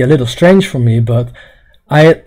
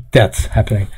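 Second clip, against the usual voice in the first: ordinary talk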